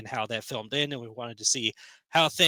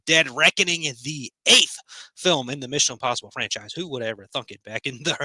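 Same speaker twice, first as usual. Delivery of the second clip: ordinary talk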